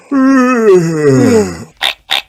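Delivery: high, drawn-out sleepy yawn; toddler yawn. Acted. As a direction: yawn like a tired little boy. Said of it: "yawns George".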